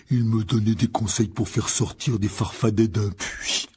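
slow, gruff, disgruntled big man; grumbling gamekeeper. A narrator's reading. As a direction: grumble heavily about a know-it-all. Voices giant gamekeeper Hagrid. (garde-chasse bourru qui ronchonne)

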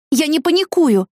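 high, sharp snapped cry; sharp shout. A narrator's reading. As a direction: snap it out too harshly. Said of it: "крикнула я слишком резко".

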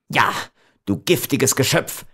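contained venomous fury; beside himself with rage. A narrator's reading. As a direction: spit out the accusation in anger. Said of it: "wiederholte Giovanni außer sich vor Wut".

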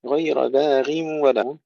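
melodic tajweed recitation; Quran recitation. Acted. Teacher recites the verse in tajweed. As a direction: chant the verse.

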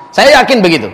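normal talking voice

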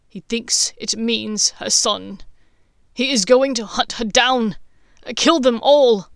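rising to a loud cry on kill; barely controlled anguish. A narrator's reading. Snape fearing for Lily, rising into a loud anguished cry. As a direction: voice straining against grief. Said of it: "barely able to school his voice".

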